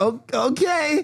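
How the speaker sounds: high, slow hesitant okay; nervous okay. Retold or imitated. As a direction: hesitate as if about to get jumped. Scared of the alley.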